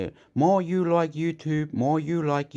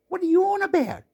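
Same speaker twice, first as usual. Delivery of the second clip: squeaky mock voice; much faster than usual. Mocking disbelief, squeaky voice.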